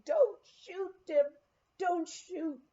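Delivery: high pleading wife's voice; begging. Retold or imitated. Wife begging her husband not to shoot, high pleading.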